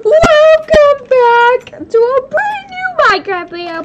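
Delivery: high, loud, hyped channel intro; YouTuber intro. Acted. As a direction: greet the viewers like a big YouTuber opening.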